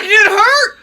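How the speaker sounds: hammed-up angry mother; mock outraged mom. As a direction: play mom's furious disbelief. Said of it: "She was like".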